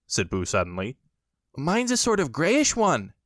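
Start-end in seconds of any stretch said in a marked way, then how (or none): 1.6-3.1 a higher timid piglet voice; a hint of a small nervous pig; mild piglet voice; lightly voiced Piglet. A narrator's reading. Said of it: "said Piglet".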